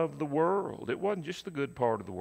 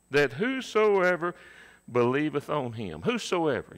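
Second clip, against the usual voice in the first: whosoever raised and louder; stress on whosoever; 3 semitones higher, 5 dB louder than usual. Shown as a demonstration. Preacher to congregation, stressing whosoever. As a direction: lean hard on whosoever. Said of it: "you see that?".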